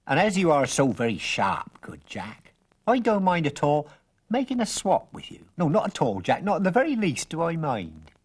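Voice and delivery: put-on coaxing old stranger. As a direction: sell the beans like a sly old peddler.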